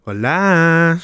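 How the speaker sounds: hammed-up Mexican accent, one word; mock Mexican greeting. Host tries a Mexican voice on request, hammed-up accent. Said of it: "Have I got a Mexican".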